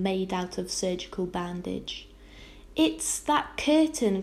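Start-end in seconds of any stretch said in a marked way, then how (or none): 2.8-4.2 drawn-out, higher-pitched cry of worry; mildly distressed outcry. A narrator's reading. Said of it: "cried Homily".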